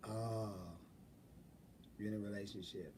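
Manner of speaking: very soft, low retold reply; gone quiet. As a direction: imitate him going quiet and small. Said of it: "He got real quiet".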